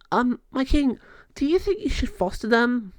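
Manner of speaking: high, tentative voice; hesitant queen. Acted. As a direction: ask the king timidly. Queen asks king hesitantly.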